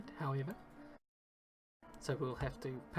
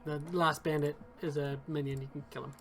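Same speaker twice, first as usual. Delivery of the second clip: storytelling game master voice; game master narration; 7 dB louder than usual. Acted. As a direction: set the scene like a dungeon master. Game master describes the scene.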